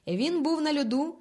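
a father hurls a grim accusation; menacing. A narrator's reading. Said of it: "грізно звернувся батько Толин". (грізно, голосно, з погрозою)

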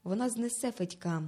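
ordinary delivery

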